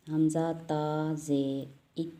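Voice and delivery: "हमज़ा, ता, ज़ेर, इत" spelled out slowly, tongue held up; slow spelling drill. Shown as a demonstration. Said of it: "ज़बान आपकी चिपकी रहे ऊपर". ("इत" धीरे-धीरे हिज्जे करके)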